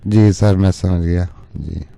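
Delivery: ordinary delivery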